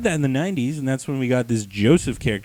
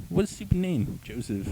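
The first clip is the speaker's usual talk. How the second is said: ordinary delivery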